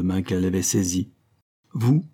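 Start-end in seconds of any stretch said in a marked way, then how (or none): 1.7-2.0 a strained, stunned rising "Vous?"; with difficulty. A narrator's reading. Marianne, stunned by Prosper's proposal, struggles to speak. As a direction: barely get the word out. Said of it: "dit-elle péniblement".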